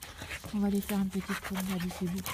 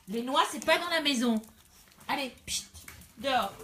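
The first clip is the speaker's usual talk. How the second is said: sharp loud telling-off, higher and louder; scolding the dogs; 3 semitones higher, 8 dB louder than usual. Real, not acted. Owner scolding her dog for nuts indoors, loud and sharp.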